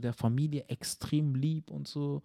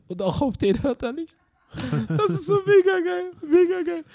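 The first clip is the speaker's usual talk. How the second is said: high, loud, thrilled gushing; can't contain the glee; delighted laughing praise; excited; 8 dB louder than usual. Real, not acted. Excited gushing about the dog.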